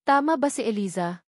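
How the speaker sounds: ordinary delivery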